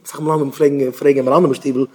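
usual speaking voice

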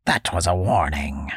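put-on creaky old man, bossy; a gruff hermit giving orders; old man's orders. Old man warns boy.

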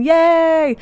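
high, loud cheer; excited yay. Real, not acted. Cheering her friend Jeffrey's win, loud and high.

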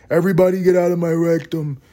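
hammed-up high announcement; mock sound bite. Mock replay of his sound bite.

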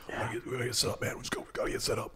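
hushed, hurried imitation of Ez; gruff imitation of Ez. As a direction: mimic Ez's terse hurry. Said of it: "Ez would come in, no small talk".

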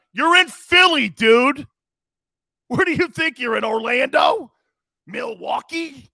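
loud, high ranting call-out; mock yelling at a player. Real, not acted. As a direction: shout him down like a heckling fan. Yelling at Carmelo Anthony.

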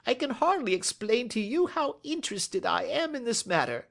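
very high-pitched little-man voice; nervous, eager little man. Acted.

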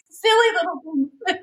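laughing through tears. Real, not acted. Laughing and tearing up.

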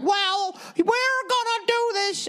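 very high, prim mock congresswoman; politician lady voice. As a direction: squeak it out like the prim representative. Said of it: "who talks like this all the time".

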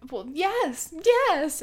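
squeaky-high retold yes; mock acceptance. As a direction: imitate a heroine accepting a proposal. Retells heroine accepting the proposal.

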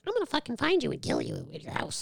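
put-on high, hushed little-kid threat; imitated threatening kid. Kid threatening the teacher, high imitated child voice. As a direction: put on a small kid's high voice, kept soft.

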